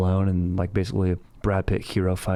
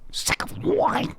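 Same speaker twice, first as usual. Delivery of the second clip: very high, strained, drawn-out mock scream; hammed-up crazed insult, stretched long; psycho outburst; much slower than usual. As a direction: screech it like an unhinged movie king. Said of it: "sounded like a psycho when he said it".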